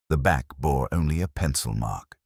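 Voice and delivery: plain reading voice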